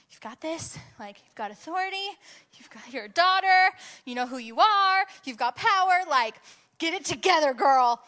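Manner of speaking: high-pitched pep talk, building to loud; self pep talk. Acted. Minister urging herself on before facing him, rousing pep talk.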